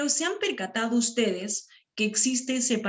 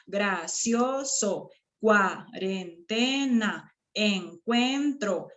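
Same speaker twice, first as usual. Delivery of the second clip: slow, separated syllables; drawn-out teacher's syllabification; much slower than usual. Shown as a demonstration. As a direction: sound out each syllable in turn. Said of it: "esas serían las sílabas".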